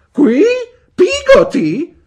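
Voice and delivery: very high, loud call, drawn out; shouting. A narrator's reading. Aunt Betsy calling the servant from the parlour door, shouting. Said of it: "gridò Miss Betsy".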